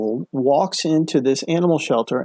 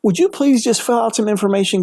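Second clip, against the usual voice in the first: higher, gushing thanks from a shelter worker; gushing grateful worker; 7 semitones higher than usual. Retold or imitated. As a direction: pour out thanks to a kind stranger. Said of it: "the shelter worker was like".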